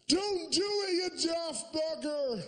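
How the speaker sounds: put-on high, slow old man's warning; a pensioner shouting a warning up at him; old Bristol fella. Old stranger pleading with a man on the edge, high and slow. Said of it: "Old fella about seventy just wandered up and went".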